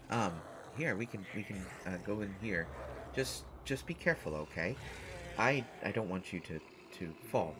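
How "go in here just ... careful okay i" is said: usual speaking voice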